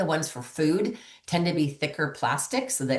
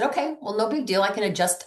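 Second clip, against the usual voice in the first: reassuring; 6 semitones higher than usual. Retold or imitated. Reassuring a customer she calls.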